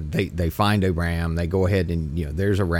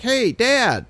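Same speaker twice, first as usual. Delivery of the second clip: put-on kid's voice; 3 dB louder than usual. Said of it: "And his kid's like".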